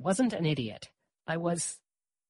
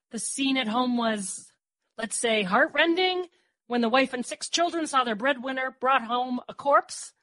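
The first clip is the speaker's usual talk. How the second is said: mock newspaper prose; 7 semitones higher, 5 dB louder than usual. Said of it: "something like".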